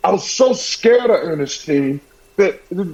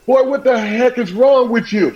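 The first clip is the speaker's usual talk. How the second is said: higher-pitched imitation of a scolding mother; mock a mother's exasperated demand, pitched up; 8 semitones higher than usual. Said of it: "And she said".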